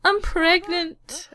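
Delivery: high, drawn-out mock announcement; pregnancy announcement. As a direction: play the Sim breaking her news, slowly and high. Voicing the character's pregnancy announcement.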